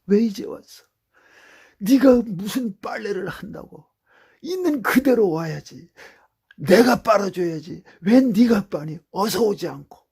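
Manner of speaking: loud, high loving call; God as a parent. Acted. God speaking like a parent to the returning sinner, loud and high. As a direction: call him home with loud love. God's voice in prayer.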